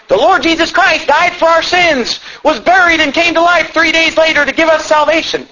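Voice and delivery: high-pitched, hammed-up earnest testimony; earnest converted bully. Saved bully telling kids about Jesus, mock earnest. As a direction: play an eager comic-book convert.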